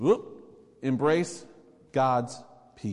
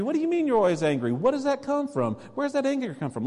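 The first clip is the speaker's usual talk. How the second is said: much higher, fast pressing questions; probing questions; 11 semitones higher, faster than usual. Retold or imitated. Counselor probing the confession.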